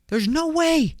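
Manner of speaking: high-pitched protest; incredulous. Real, not acted. Podcaster refusing that French is most romantic, incredulous. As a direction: leap way up in disbelief.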